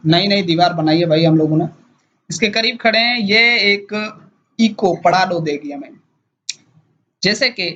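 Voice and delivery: ordinary talk, nothing marked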